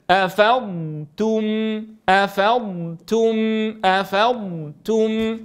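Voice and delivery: أفضتم with a long ض then a ت with u; correct slow recitation. Shown as a demonstration. Teacher to students, modelling أفضتم slowly. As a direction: stretch the ض of أفضتم, then a clean تُ. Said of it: "بإعطاء الضاد حقا من الاستطالة".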